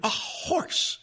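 high, shocked librarian; horrified high-pitched disbelief; shuddering shock. A narrator's reading. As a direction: gasp it high, aghast at what stands there. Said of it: "and she shuddered".

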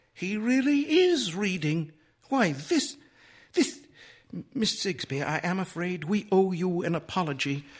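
high-pitched, halting disbelief; a high matron's voice, stumbling into a stiff apology; astonished society lady. A narrator's reading. Astonished Missus Dillway apologises in story.

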